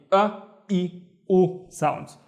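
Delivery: "a, e, u" each with a glottal catch; hamza vowels. Shown as a demonstration. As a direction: run through "a, e, u" with catches. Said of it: "the letter that is responsible for the".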